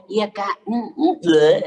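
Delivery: high coy cooing, ending in a loud blurt; hammed-up girly coo with a burst at the end; mock coy girlfriend. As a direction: tease a coy girl sweet-talking her boyfriend. Mocking girl cooing to boyfriend.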